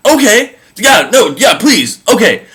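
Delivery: exaggerated fake agreement; sarcastic. Eve sarcastically accepting painful childbirth from God, mock shout.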